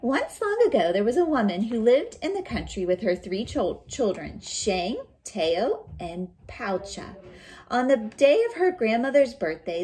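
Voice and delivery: loud, lively read-aloud voice; storybook reading. Acted. Teacher reading a folktale aloud to her students, loud and lively. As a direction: read it big for the class.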